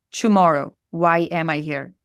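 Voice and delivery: normal talking voice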